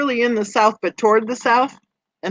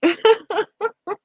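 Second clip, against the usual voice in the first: high laugh; 12 semitones higher than usual. Real, not acted. Reading chat, laughs out.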